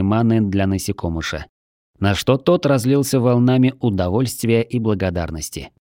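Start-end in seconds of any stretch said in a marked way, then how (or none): none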